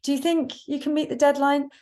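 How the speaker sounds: rising, unsure asking; tentative question. Shown as a demonstration. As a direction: ask it hesitantly, rising high. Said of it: "instead of asking for information".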